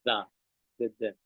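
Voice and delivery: "Da" con la lengua como una D sonora; sonido TH como D. Shown as a demonstration. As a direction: di "Da, d, d" marcando la d. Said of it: "Uno es más como una D". ("Da" como una d suave)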